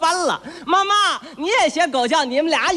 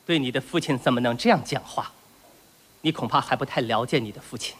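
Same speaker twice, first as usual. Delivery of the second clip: low, hushed stern father lecture; 11 semitones lower, 6 dB quieter than usual. Acted. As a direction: play the strict dad talking down to his boy.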